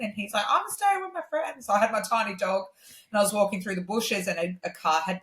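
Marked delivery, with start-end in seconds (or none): 0.5-1.7 high-pitched mock refusal; imitating defiant brother. Brother refusing to go home with his sister, imitated defiantly. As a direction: put on a kid brother's high retort. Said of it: "had actually had a fight".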